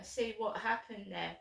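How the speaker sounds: ordinary talk, nothing marked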